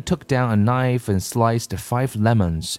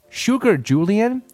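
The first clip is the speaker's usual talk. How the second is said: high quick call of sugar; father calling for sugar; 13 semitones higher than usual. A narrator's reading. Father busy beating, calling Julian for sugar, high. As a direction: call out for it mid-stir. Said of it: "he said".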